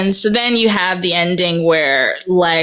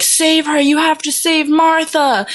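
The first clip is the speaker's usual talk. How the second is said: higher, desperate mock plea; pleading Clark; 8 semitones higher than usual. Superman begging Batman to save his mom, mock desperate plea. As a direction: beg him to save his mother, lightly hammed. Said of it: "and he's like".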